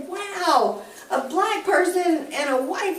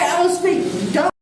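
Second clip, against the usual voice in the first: ordinary talk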